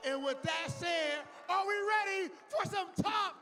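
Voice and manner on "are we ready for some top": hype announcer. Acted. Host hypes top eight.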